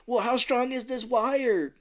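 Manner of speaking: high, drawn-out anxious question; slow, hammed-up nervous query; mock worry. Retells Gribble doubting the wire.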